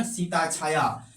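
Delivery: Sita Chhaya drawn out, syllable by syllable; slow example. Shown as a demonstration. Teacher slowly dictates Sita Chhaya to students taking notes. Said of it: "यही चीज तो बता रहे थे ना".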